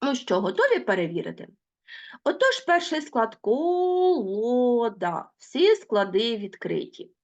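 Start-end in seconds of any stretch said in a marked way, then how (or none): 3.4-5.3 very slow, each syllable spaced apart. Shown as a demonstration. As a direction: spell out Безпідставний slowly by syllables. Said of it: "teacher splits word into syllables". (повільно, по складах)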